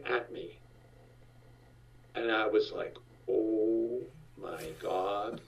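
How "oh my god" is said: soft, slow laughing oh my God; stunned laugh. Retold or imitated. Dad seeing the mob come over the hill, laughing softly. Sees mob coming at him.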